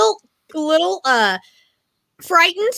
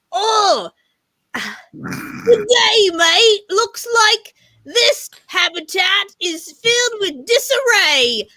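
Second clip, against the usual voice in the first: cheery Aussie tour of a messy room; Steve Irwin impression; 3 semitones higher than usual. Friend voicing Steve Irwin describing her cluttered habitat, light Australian accent. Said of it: "he would be like".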